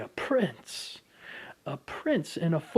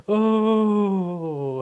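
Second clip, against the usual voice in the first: mock gasp; 7 semitones higher, 10 dB louder than usual. Mocking gasp in thoughts.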